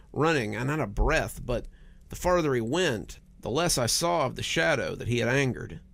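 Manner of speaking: ordinary delivery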